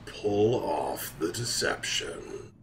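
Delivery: slow, hushed, dramatic trailer voice; milk each phrase like a hammed-up film trailer; drawn-out suspense voice-over; mock movie trailer narrator.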